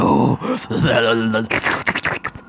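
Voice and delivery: loud, drawn-out monster cackle; evil laugh. Acted. False mother gloats over the scared daughter, evil cackle. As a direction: cackle long after delivering the threat.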